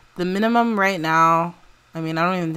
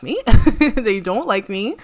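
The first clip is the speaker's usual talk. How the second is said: half-laughing whine, louder; laughing complaint; 5 semitones higher, 8 dB louder than usual. Pretend. Celine laughing as she complains about coworkers. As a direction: giggle through the complaint.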